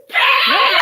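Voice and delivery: shrill screeching wyvern shriek; pterodactyl scream. Pretend. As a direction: let out a high prehistoric screech. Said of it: "do the pterodactyl scream".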